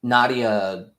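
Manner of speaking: ordinary delivery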